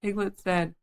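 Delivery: plain reading voice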